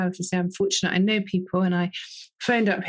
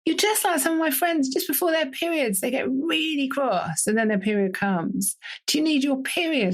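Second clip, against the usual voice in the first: lightly voiced teenage daughter; 6 semitones higher than usual. Daughter voiced in retold story.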